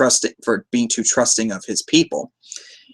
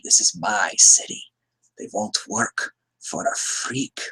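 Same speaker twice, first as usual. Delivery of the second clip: Joker voice; 4 semitones lower, 4 dB quieter, slower than usual. Retold or imitated. Retelling the Joker's lines.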